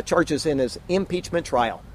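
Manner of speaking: normal talking voice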